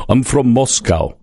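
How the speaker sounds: slow, mock Russian-accented line with Moscow said as Moss-cow; movie Russian accent. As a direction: imitate a movie actor faking Russian. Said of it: "it stands out for me a little bit when they say".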